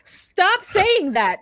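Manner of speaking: high, very slow plea, each word stretched; mock exasperation. Host telling him off in his absence, mock exasperation. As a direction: drag it out like you've had enough.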